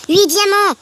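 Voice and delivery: high, loud triumphant announcement; jubilant excitement. Real, not acted. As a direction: crow the final count to the viewers. Count of diamonds reaches eight. (annonce aiguë et euphorique)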